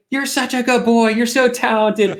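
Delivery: high, loud gushing mother, slowing down; doting mom. Retold or imitated. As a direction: coo over him like a proud mom. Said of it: "She goes".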